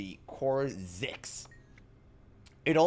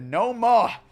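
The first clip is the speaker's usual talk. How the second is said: high, loud farmer's yell; shouted; 10 semitones higher, 10 dB louder than usual. A narrator's reading. Farmer chasing his runaway bird in a children's book, loud shout.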